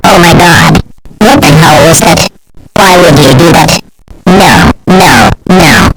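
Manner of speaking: loud panicked yelling; shouting. Acted. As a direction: yell at them to let go. Panicked kid grabbed and carried.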